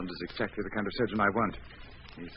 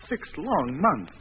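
slow, drawn-out and louder; anguished; 7 semitones higher, 7 dB louder, much slower than usual. Acted. Patient despairing about months in bandages, slow and pained. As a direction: stretch out every word in despair. Anxious patient dwells on ordeal.

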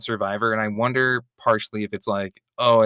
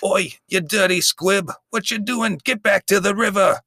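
posh British; 9 semitones higher than usual. Pretend. To the punk who spilled his crumpets, a high posh shriek. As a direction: shriek it like an offended London toff. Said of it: "you bump into me and knock all my crumpets".

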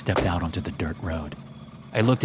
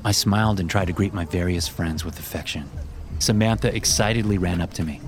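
ordinary delivery, nothing marked

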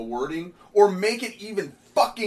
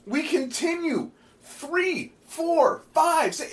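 a fed-up critic who can't take more text; exasperated; 10 semitones higher, slower than usual. Real, not acted. Ranting to viewers about wordy comic pages, exasperated and pitched high.

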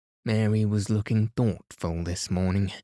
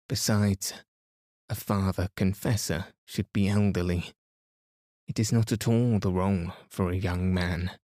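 ordinary delivery

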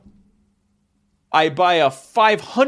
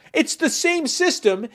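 worked-up rant; 12 semitones higher, slower than usual. Real, not acted. Ranting after the punchline.

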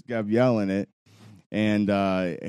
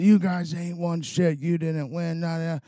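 higher-pitched, indignant mock protest; pissed-off heckler; 9 semitones higher than usual. Rival fan disputes the win, pissed off. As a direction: imitate a sore loser sputtering in a raised pitch. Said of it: "he's all pissed off".

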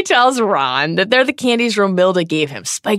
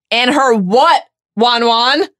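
loud, raised teasing question; mock-prompting, louder and higher; 5 semitones higher, 5 dB louder, much slower than usual. A narrator's reading. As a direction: tease him with a pet name, pitched up. Harry mocking lovesick Ron.

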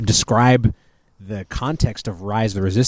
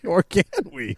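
high, soft put-on echo; playful copycat voice, pitched up; Walt Disney; 8 semitones higher, 5 dB quieter than usual. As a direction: laughingly echo the comic impersonation. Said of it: "In that voice".

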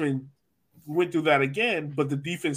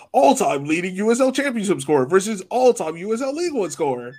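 higher, hammed-up promo-flyer read; mock hype announcer; 6 semitones higher, 5 dB louder than usual. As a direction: announce it like an over-the-top poster slogan. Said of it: "everyone was like".

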